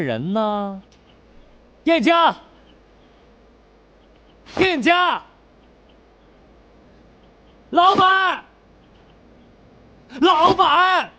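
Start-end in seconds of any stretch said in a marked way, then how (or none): 1.8-11.1 high, loud hail for the innkeeper; shouted high call for service. Acted. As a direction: call across the shop to summon someone. Calling out for the innkeeper.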